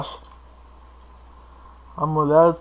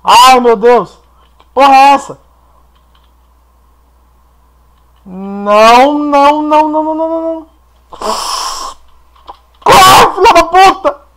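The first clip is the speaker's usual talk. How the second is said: high loud terrified screams; being chased; 9 semitones higher, 13 dB louder than usual. Real, not acted. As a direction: shriek no over and over, fleeing. Monster chasing player in game.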